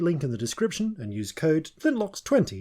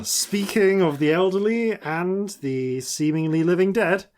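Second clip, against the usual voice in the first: ordinary talk, nothing marked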